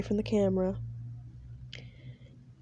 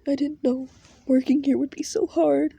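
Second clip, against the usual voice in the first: higher, louder upset voice; tearful girl; 5 semitones higher, 6 dB louder than usual. Acted. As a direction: complain through tears. Crying girl after abuse.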